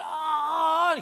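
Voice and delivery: high, drawn-out wailing "aah"; imitated shout. Preacher retells another patient crying out, high imitated shout. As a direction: act out a patient's high cry. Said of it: "when he shouted".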